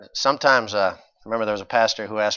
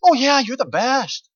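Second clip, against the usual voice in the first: much higher, slow cooing praise; gushing flatterer; 14 semitones higher, 7 dB louder, slower than usual. Retold or imitated. Mocking the flattery people fish for, high and cooing. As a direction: gush it out sweetly. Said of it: "we just want somebody to say".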